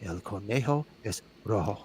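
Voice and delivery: low, soft Spanish film voice; imitating little voice. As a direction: slip in a quiet, low mysterious voice. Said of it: "you'd hear a little voice go".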